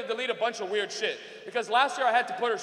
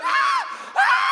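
very high, loud screams imitating Mom; mother's mock scream; 7 dB louder than usual. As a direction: shriek twice as a horrified Mom. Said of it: "Why are you yelling like that?".

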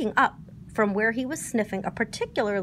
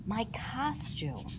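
much softer, slow thoughtful murmur; musing; 11 dB quieter, much slower than usual. A narrator's reading. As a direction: muse it to yourself, drifting. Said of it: "Pooh mused".

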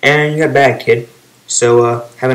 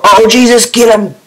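high, loud burst of excitement; excited shout; 10 semitones higher, 6 dB louder than usual. Real, not acted. Gamer urging on the kill in his clip, excited shout. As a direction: urge the fighter on, loud and high. Cheering on a fight in commentary.

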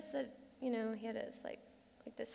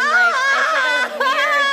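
wailed confession; 14 semitones higher, 14 dB louder, much slower than usual. Acted. Confession collapses into uproar.

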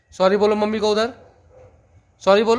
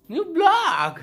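"new blog" again way up high in a put-on voice; squeaky high retake; 12 semitones higher than usual. Shown as a demonstration. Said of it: "new blog repeated higher". (ऊँची पतली आवाज़ में "new blog")

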